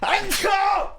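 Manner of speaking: abrupt loud yelp; sudden shout. Real, not acted. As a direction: blurt it out loud and high. Sudden outburst mid-talk.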